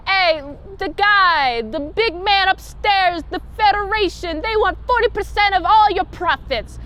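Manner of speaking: put-on loud, high wiseguy gangster accent, slowed at first; strong-arm them like a Chicago mobster; 1920s Chicago mob accent. Kirk shaking down gangsters in a Chicago mob accent. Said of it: "cute Chicago mob nineteen twenty accents".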